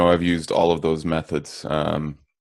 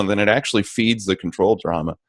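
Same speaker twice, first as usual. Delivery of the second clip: normal talking voice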